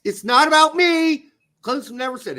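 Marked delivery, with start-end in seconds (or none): 0.0-1.3 high, loud, insistent protest; emphatic quote. Retold or imitated. Host quoting the coach to make his point, loud and emphatic. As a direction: raise pitch and volume to drive it home.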